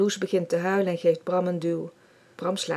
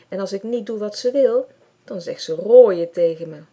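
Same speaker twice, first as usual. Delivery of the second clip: upset; 3 semitones higher, 10 dB louder than usual. Acted. Tearful Bram complains.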